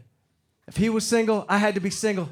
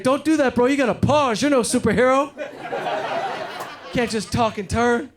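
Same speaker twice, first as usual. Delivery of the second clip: high, loud mock scolding; lecturing friend; 6 semitones higher, 4 dB louder than usual. Friend scolding him for turning while talking, mock lecture. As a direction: scold him like a wise buddy.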